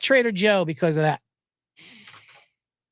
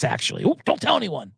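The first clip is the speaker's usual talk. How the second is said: normal talking voice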